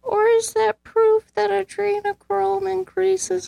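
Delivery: high slow mock-spooky question; spooky conspiracy voice. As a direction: tease it like a mystery host.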